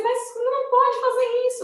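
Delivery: high, urgent re-enacted plea; retold pleading. Mother begs son not to do it, high retold pleading. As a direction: beg in alarm, pitch held up.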